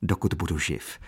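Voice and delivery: ordinary delivery, nothing marked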